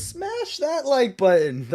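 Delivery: high, drawn-out mock battle cry; imitating wife. Retelling wife wielding a Thor hammer, playful high imitation. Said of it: "my wife ... she goes".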